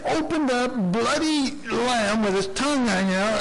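usual speaking voice

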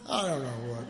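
usual speaking voice

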